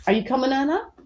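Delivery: high sing-song voice for a cat; kitty cat voice. Real, not acted. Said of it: "the kitty cat voice".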